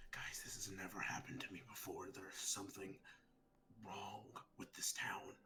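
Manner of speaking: hushed unvoiced whisper, uneasy; shaky whisper. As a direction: whisper it like a spooked secret. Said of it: "I'm kind of like, shuddering".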